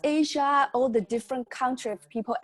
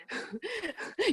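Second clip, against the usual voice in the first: a quick high laugh breaking into the quote; short laugh; 11 semitones higher, 9 dB quieter than usual. Real, not acted. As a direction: laugh lightly at the memory. Laugh mid-quote, retelling.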